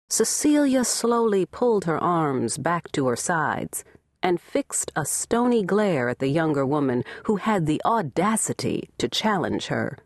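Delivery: plain reading voice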